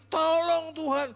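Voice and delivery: high, loud, slow begging; desperate plea. Retold or imitated. Preacher showing how the mother begged relentlessly, high and loud. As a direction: beg with everything you have.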